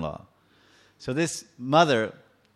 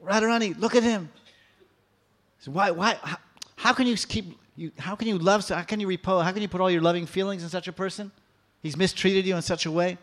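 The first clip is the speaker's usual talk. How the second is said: higher, pleading outrage at her friend; angry reproach; 6 semitones higher than usual. Acted. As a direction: scold her for loving him. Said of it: "Lalita's boiling with anger".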